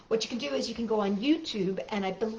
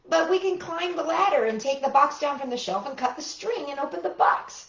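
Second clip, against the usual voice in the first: Toad voice; 8 semitones higher, 3 dB louder than usual. Acted. Toad's higher voice.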